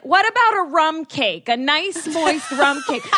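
hammed-up enthusiastic father; insistent dad. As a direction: imitate a dad pushing his idea. Retold dad ignoring her, suggesting cake.

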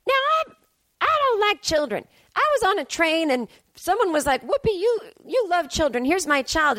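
imitating Whoopi telling her train story; Whoopi Goldberg impression. Comedian retelling Whoopi's remarks on The View, mock Whoopi voice. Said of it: "and Whoopi's like".

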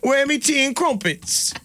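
high mock Cockney whine. Harry wanting his tea, high mock Cockney complaint. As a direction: ask for your tea in Cockney. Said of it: "Why are they Cockney now?".

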